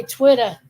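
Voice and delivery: Twitter as "TWIT-uh", no final R; dropped R. Shown as a demonstration. Confirming the local way, Twitter as "TWIT-uh". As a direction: end Twitter on "uh". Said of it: "they don't pronounce their R's".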